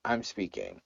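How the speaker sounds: calm but firm, gently cutting in; polite. Retold or imitated. Kamala stopping the interrupting Pence, politely and quietly. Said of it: "did it in a polite way".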